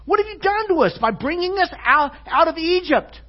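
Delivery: very high, loud mock outrage; big-time sarcasm. Israelites accusing Moses, high loud sarcastic protest. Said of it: "big-time sarcasm".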